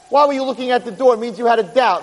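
high pitch, gradually easing down; insistent argument. Acted. Lawyer arguing reasonable doubt to jury, insistent. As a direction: drive the conclusion home, descending from the peak.

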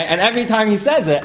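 ordinary talk, nothing marked

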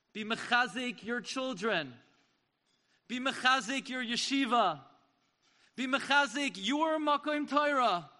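building, loud exhortation; rally the congregation, rising; fervent sermon peak; preaching climax. Real, not acted.

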